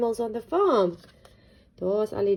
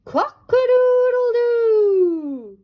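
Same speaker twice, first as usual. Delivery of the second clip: high, loud, slow crow; rooster crow; 8 dB louder, much slower than usual. Acted. Cockerel shows off his call, loud crow. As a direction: crow it out proudly. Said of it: "showed off his magnificent call".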